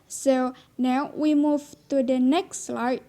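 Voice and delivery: ordinary talk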